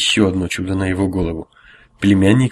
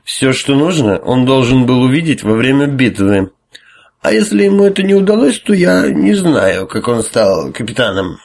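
high, loud, slurred haughty lecture; drunken priest; 4 semitones higher, 4 dB louder than usual. Acted.

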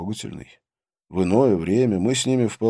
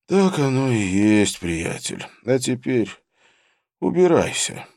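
menacing gangster voice. A narrator's reading. Vishnu dismisses the narrator.

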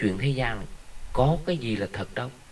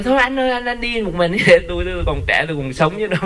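much higher, half-laughing mock shriek of a frightened widow; widow shrieking in panic; 7 semitones higher, 4 dB louder than usual. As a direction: play the widow crying out that she is still young, high and loud. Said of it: "bà la làng quá trời".

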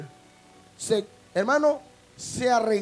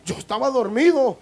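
indignant woken corpse; 2 semitones higher, 7 dB louder than usual. Retold or imitated. Woken dead man protesting.

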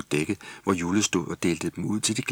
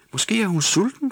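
much higher, louder helpful voice; Jule suggesting; 4 dB louder than usual. A narrator's reading. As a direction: offer the idea brightly. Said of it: "foreslog Jule". (Jules høje stemme)

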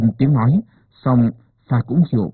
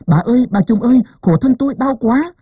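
shrieking call; 11 semitones higher than usual. A narrator's reading. Wife in labour shrieking for the midwife, high desperate cry. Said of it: "rú lên gọi dồn".

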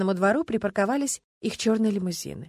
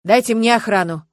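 louder insistent demand; 4 semitones higher, 11 dB louder than usual. A narrator's reading. Witness demanding a guard from the detective, insistent. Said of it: "потребовал Варягин".

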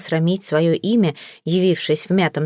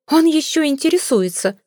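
much higher, outraged echo of the same line; mother repeating herself to the suitor, higher and indignant; shrill indignant repeat; 9 semitones higher than usual. Acted. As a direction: throw it back at him, higher and more scandalised. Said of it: "повторила миссис Флип".

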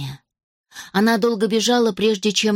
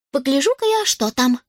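put-on much higher elf girl voice; 6 semitones higher than usual. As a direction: say it like a curious elf.

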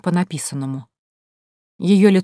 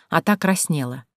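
usual speaking voice

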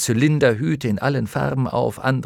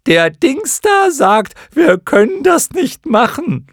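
very high, slow, loud squawk; drawn-out shout in a silly creature voice; creature calling up; 10 dB louder than usual. A narrator's reading. Clown moth below relays to those above, calling up. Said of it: "ein anderes rief von unten hinauf".

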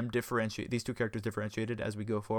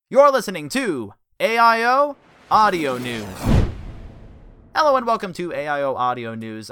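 announcer intro; 6 semitones higher, 12 dB louder than usual. Acted. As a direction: open the show like a broadcaster. Show intro read.

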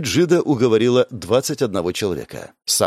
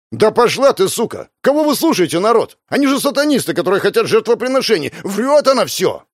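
much higher, loud, frantic rant; furious yelling; 11 semitones higher, 4 dB louder than usual. Acted. Fan screaming at the crowd that they are satanists, frantic and loud. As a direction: scream accusations to turn the crowd.

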